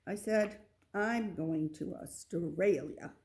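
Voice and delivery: ordinary delivery, nothing marked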